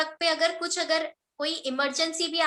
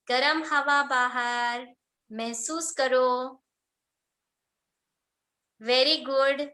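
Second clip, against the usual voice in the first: guided meditation; 2 semitones lower, slower than usual. Acted. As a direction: calmly lead the class through each breath.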